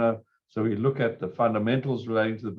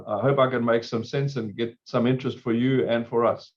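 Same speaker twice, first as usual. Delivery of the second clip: normal talking voice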